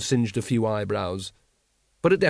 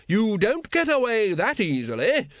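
triumphant lady; 9 semitones higher than usual. A narrator's reading. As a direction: crow with triumph. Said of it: "said Lady Ramkin triumphantly".

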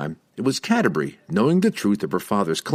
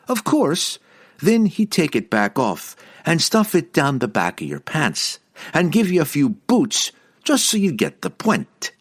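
lively, higher-pitched teasing jab; animated character voice, higher and teasing; teasing explanation; 7 semitones higher than usual. A narrator's reading. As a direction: explain it with mock matter-of-factness.

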